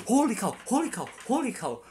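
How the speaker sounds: high, loud mock cry of alarm; high-pitched repeated yell, acted out; imitated shout. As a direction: shout it like a panicked moviegoer, imitating him. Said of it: "there's a guy shouted like".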